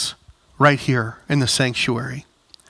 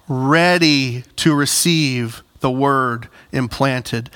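ordinary delivery

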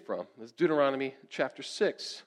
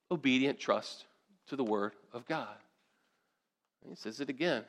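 ordinary talk, nothing marked

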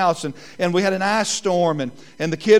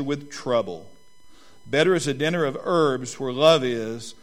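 scripture reading; 4 semitones lower than usual. Acted. Pastor reading Proverbs to the congregation, measured. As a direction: read the verses with weight.

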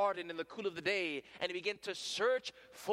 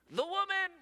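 blaming whine; 6 dB louder than usual. Acted. Adam blames his wife.

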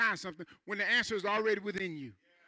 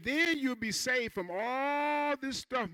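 slow promise; 4 semitones higher, much slower than usual. Acted. Preacher slows the promise.

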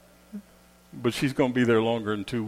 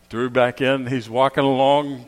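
ordinary delivery

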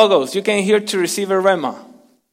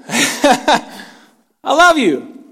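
general declaration; 6 semitones higher, much slower than usual. Shown as a demonstration. Preacher saying I love you to everyone, higher and broadcast. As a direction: declare it broadly to the whole room. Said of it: "I said it in a general way".

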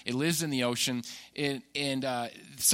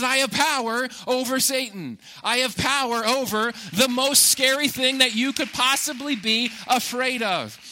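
high, loud triumphant declaration; voicing Jesus with full force; proclaiming Jesus; 7 semitones higher, 4 dB louder than usual. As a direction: preach it as Jesus claiming victory. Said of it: "Jesus says".

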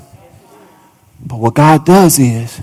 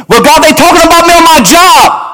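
high, loud shouted complaint; a believer wailing to God about work; whiny believer's complaint; 12 semitones higher, 4 dB louder than usual. Acted. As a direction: cry out to God in protest.

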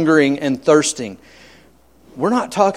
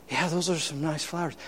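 much softer, a polite mumble of forced interest; mumbled reply; 12 dB quieter than usual. Retold or imitated. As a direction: humor the host under your breath. Hungry pastor answers politely.

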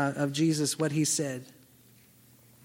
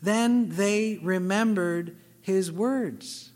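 slow emphatic reading; much slower than usual. Real, not acted. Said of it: "the whole passage could focus on verse eight".

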